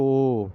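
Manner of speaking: "ओ" held as a long steady oh; long vowel sound. Shown as a demonstration. Teacher showing the letter's sound, "ओ" held long. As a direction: stretch "ओ" out evenly. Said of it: "इन आवाज़ों को".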